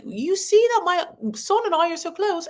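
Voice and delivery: high, lightly put-on accent of Jo, quickly abandoned; a half-hearted stab at the mother-in-law's accent; attempted accent of Jo. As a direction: try Jo's accent and give up on it. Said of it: "I can't do that accent".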